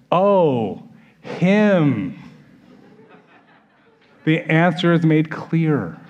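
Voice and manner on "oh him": mock realisation. Disciples at the table spotting Judas, exaggerated realisation. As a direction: let the penny drop slowly and obviously.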